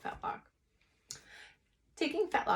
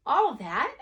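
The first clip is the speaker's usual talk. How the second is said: astonished; 6 semitones higher, 9 dB louder than usual. Acted. Mother hears the huge order.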